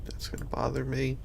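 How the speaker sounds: soft unvoiced aside; whispering. Real, not acted. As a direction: whisper it to yourself. Quiet aside to self.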